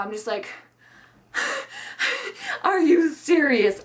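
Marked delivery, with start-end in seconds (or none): 1.3-2.6 high breathy burst of laughter; short disbelieving laugh before answering; incredulous laugh. Retold or imitated. Stunned laugh before retold retort. 2.6-3.8 drawn-out, louder, higher mock disbelief; acted incredulity. Retold incredulous reaction after laughing.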